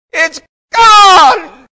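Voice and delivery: full-force, high-pitched shout of deliverance; top-of-voice yell. Retold or imitated. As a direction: yell it through the whole church, holding nothing back. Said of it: "at the top of his voice, he yelled".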